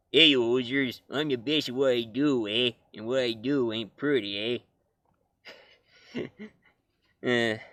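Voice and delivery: jokey Canadian Wolverine with eh; lightly voiced Canadian tough guy; mock Canadian accent. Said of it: "it'd be kinda funny if we heard Wolverine sound like this".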